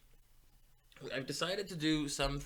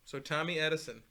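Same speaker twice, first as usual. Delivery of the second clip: ordinary talk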